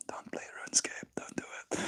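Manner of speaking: faint unvoiced whisper. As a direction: breathe the warning very softly. Whispered warning aside.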